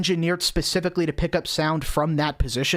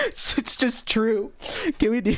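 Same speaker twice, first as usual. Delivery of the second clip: laughing; 6 semitones higher than usual. Real, not acted. Laughing through his words.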